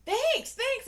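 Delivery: high, loud mock thank-you; imitated thanks. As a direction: mimic an eager, high-pitched reply. Acts out the man's reply.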